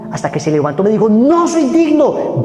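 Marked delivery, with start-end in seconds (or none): 1.1-2.4 high, very slow, loud tearful wail; tearful cry. Retold or imitated. Addict weeping to the preacher, slow and loud. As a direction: drag each word out in tears. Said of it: "bañado su rostro en lágrimas".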